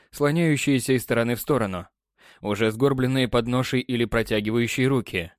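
ordinary delivery

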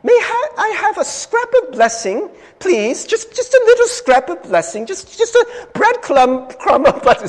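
put-on high, pleading beggar boy in hammy British; badly faked British urchin begging for scraps; bad Dickensian British accent. Beggar boy begging a gentleman for blessing, terrible British accent. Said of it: "British accent, Dickensian British accent is terrible".

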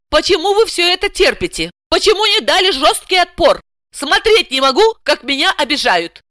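high-pitched, offended protest; indignant. A narrator's reading. Client scolding her translator for tolerating attacks, indignant. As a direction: demand answers in outrage. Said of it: "возмутилась она".

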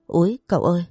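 plain reading voice

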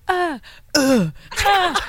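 short mock moans, drawn out; slow, teasing "uh" noises; imitated sex moans. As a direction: imitate moans heard through a wall. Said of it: "we were out there and you hear".

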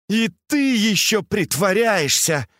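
high-pitched indignant cry; flaring anger. A narrator's reading. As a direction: flare up at the pretence. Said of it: "вспыхнув от гнева, закричала Фэнзе".

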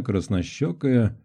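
plain reading voice

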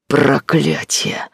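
slow, loud snarl; growled curse. A narrator's reading. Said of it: "зарычал".

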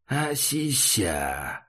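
growling clown monster; monster grumble. Acted. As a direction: drag the word out like a beast. Monster grumbles a mangled word.

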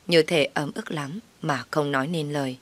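plain reading voice

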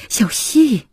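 sudden loud appeal; urgent call. A narrator's reading. Husband pleading, calls her name louder.

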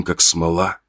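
ordinary delivery

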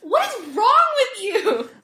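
loud, high-pitched incredulous cry; exasperated outburst. Retold or imitated. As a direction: yell it in disbelief, half laughing. Outraged reaction to the character.

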